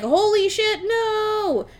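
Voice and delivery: high, loud, drawn-out mock alarm; panicked Dracula. Retelling Dracula's reaction to the news, in mock panic. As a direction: cry out in exaggerated dismay. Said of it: "So Dracula's like".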